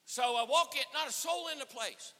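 usual speaking voice

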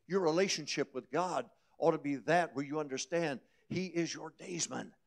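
normal talking voice

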